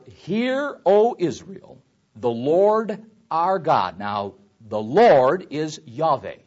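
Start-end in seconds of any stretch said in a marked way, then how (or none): 0.1-4.0 loud, raised scripture declamation; scripture reading. Shown as a demonstration. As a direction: proclaim the verse slowly to the congregation. Reads verse four to congregation.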